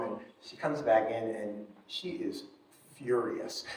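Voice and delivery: ordinary talk, nothing marked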